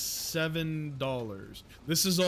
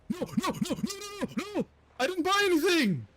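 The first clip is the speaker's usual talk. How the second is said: like the money is slipping away; panicked protest; faster than usual. Real, not acted. Streamer after a misclick in the shop, panicked wail. Accidentally buys item, panics.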